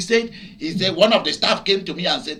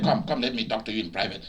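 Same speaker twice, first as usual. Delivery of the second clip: hushed aside; 10 semitones lower, 10 dB quieter, faster than usual. Retold or imitated. Shop man drawing him aside.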